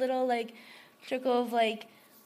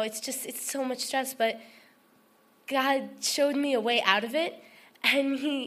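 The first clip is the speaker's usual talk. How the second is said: ordinary delivery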